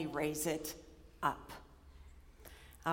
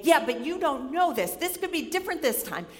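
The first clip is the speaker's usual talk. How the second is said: arguing back; 6 semitones higher, 4 dB louder than usual. Retold or imitated. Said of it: "coming back with argument after argument".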